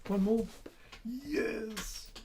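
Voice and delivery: high, excited pet voice; high, playful voice to the dog; coaxing the dog. Real, not acted. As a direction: egg the puppy on with a treat. Teasing the dog with treats.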